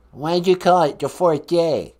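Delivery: loud fake Boston challenge; Boston accent. Columbus questioning host's translation, put-on Boston accent. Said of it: "talk with my Boston accent".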